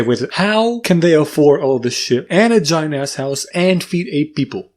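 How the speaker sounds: ordinary delivery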